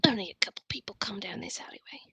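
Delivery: conspiratorial whisper; hushed alley informant whisper. Acted. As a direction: whisper it like a secret.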